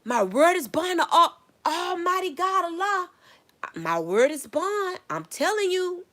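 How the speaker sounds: high, loud, pleading oath with a cry; childhood oath. Retold or imitated. Kid swearing to siblings, loud high pleading oath. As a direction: swear it like a kid insisting on innocence. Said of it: "I'd be like".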